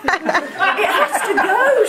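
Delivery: high, slow comic resolve; dramatic realisation. A narrator's reading. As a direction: draw it out high and emphatic.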